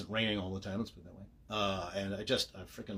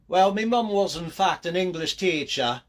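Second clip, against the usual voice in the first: loud, high put-on English accent; jokey English accent at full volume; mock English accent; 7 semitones higher, 10 dB louder than usual. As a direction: ham it up as a proud Brit. Puts on English voice, then drops it.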